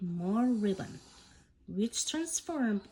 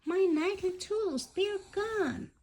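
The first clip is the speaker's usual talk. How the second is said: high shocked cry of loss; dismayed gasp; 10 semitones higher than usual. Acted. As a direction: gasp it out, tools gone, much higher. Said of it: "Nila gasped".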